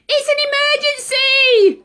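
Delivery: high, loud urgent shout. Acted. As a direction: cry out the alarm, pitched high. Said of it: "cried Ozzy".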